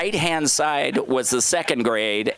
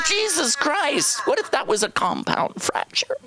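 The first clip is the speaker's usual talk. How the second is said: mock-outraged kid protesting his mom; exasperated kid. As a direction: act shocked at her carelessness. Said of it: "I'm like".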